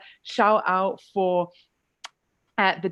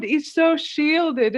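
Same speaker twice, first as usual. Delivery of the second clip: ordinary delivery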